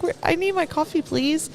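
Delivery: high, whiny plea for coffee; pleading for coffee. Retold or imitated. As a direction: beg the counter staff, pitched high.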